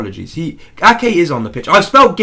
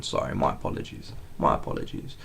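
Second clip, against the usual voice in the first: soft whispered sorry; whispered apology; 14 dB quieter than usual. Real, not acted. Whispering sorry to chat for the wrong number. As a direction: murmur the apology under breath.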